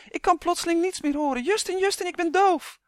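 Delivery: very high, hammed-up panicked cry; shouting. A narrator's reading. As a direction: wail loudly as if suddenly struck deaf. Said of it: "schreeuwde de elf net zo hard". (hoog en paniekerig roepen)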